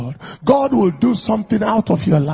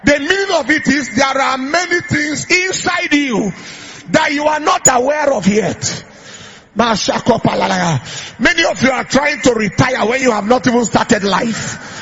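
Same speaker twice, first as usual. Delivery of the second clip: high, loud preaching voice; preaching at full force; 6 semitones higher, 5 dB louder than usual. Acted. Preacher prophesying over the congregation, full force. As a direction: preach it out with fire.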